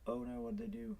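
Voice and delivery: high, soft alarmed oh no; sudden dismay. Real, not acted. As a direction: caught off guard, pitch jumps. Sudden alarm at opponent's play.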